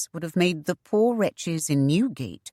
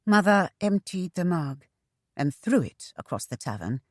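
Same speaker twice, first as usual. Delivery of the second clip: ordinary delivery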